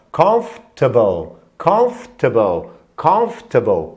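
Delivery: "comfortable" as COMF-ta-bol, the middle "or" swallowed; run together as one. Shown as a demonstration. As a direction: slowly drill "comfortable" with "comf" as one single beat, then "ta-bol". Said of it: "como se fosse uma coisa só". ("comfortable" devagar, CÓMF-ta-bol, com "comf" numa sílaba só)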